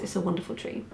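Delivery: normal talking voice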